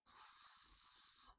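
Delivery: long, high hissing "S."; "S." as a sustained hiss, not the letter name; high-pitched S sound. Shown as a demonstration. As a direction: hold "S." as a voiceless hiss. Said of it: "Really high-pitched sound".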